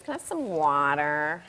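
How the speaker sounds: put-on child's "water" in American, "WAH-der"; American accent. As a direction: imitate an American kid saying "water" with a soft d. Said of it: "getting your husband's accent".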